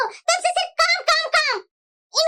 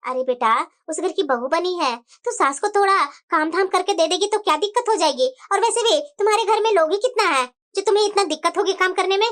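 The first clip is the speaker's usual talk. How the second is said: lecturing mother on the phone; 8 semitones lower than usual. Acted. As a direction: lower mother's voice, gently scolding.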